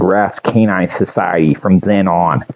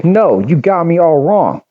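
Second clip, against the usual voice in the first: put-on higher-pitched dog protesting in a thick Jersey accent; 8 semitones higher than usual. A narrator's reading. Captured dog insisting he's been mistaken, in a thick Jersey accent. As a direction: push back in broad New Jersey tones. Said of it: "in a Jersey, a thick Jersey accent".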